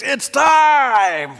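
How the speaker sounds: high, loud burst of eagerness; eager battle cry. Acted. Peter shouting as he charges the soldier, eager battle cry.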